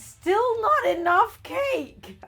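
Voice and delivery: high, slow exasperated cry; exasperated wail. Real, not acted. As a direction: wail it in despair. Exasperated baking.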